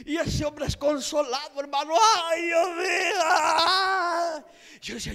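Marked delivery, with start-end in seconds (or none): 2.2-4.4 crying husband. Retold or imitated. Husband crying outside the room.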